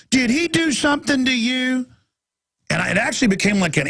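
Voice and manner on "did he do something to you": put-on high baby-talk voice to a dog; cooing to the dog. Stranger at the park talking straight to the dog in mock baby talk. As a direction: coo at the dog, higher, ignoring the owner. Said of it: "or right in front of you".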